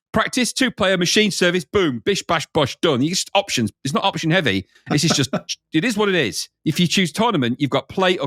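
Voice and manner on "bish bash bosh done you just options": normal talking voice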